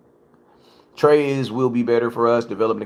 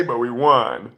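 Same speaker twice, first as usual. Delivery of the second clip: mocking fans; slower than usual. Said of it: "you know what you guys say".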